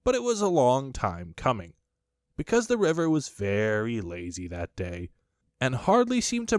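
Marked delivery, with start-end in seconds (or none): none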